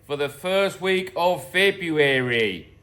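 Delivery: slow, droning Australian accent, lightly put on; Sean's Australian accent. [name] standing in for absent Sean, slow mock Australian accent. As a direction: drawl it out like a laid-back Aussie. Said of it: "It's drone, isn't it, the Australian accent?".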